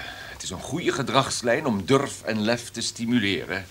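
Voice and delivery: usual speaking voice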